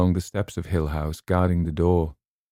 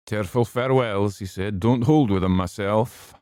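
higher, louder blunt grumble; gruff old man; 4 semitones higher, 4 dB louder than usual. A narrator's reading. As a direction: dismiss it bluntly. Arthur voiced by narrator.

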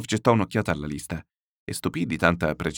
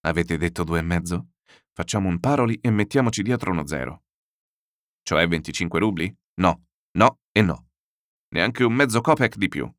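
plain reading voice

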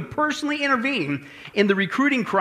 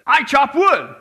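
higher, louder mock intern voice; intern's insistent declaration; 4 semitones higher, 5 dB louder, slower than usual. [name] answers the general once more, loud and insistent.

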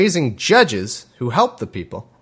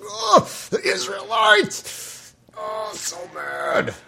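high, slow mock shout that sinks at the end; an old man fuming about the Israelites; hammed-up outraged wail; exasperated old God; much slower than usual. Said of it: "eyes popping out all the time".

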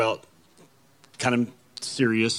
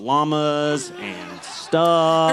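drawn-out mock pitch; much slower than usual. Drawn-out mock hype for podcast.

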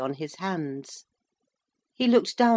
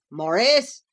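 louder, higher calling of a name; shouted call; 5 semitones higher, 6 dB louder than usual. A narrator's reading. Mister Basingstoke calls from the door for Maurice, shouting. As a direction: call out sharply to summon him. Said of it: "shouted Mister Basingstoke".

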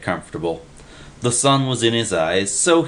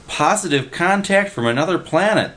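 high excited yell; 10 semitones higher than usual. A narrator's reading. Willie bursts in with the news, high yell. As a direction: shout the headline up high. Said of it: "the guy was yelling".

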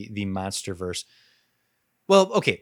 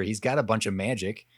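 usual speaking voice

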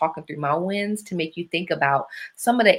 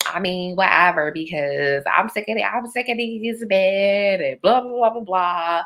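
foolishness talk voice; 3 semitones higher than usual. Shown as a demonstration. Showing listeners her sick-of-men rant, foolishness talk voice. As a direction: trail into long, stretched blah-blahs. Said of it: "that's my foolishness talk voice".

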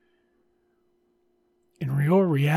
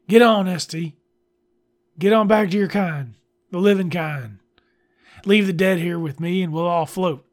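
loud, high mock yell; 4 semitones higher, 7 dB louder than usual. Brother yelling at sibling to leave, loud and high. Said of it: "thrown it at me and yelled".

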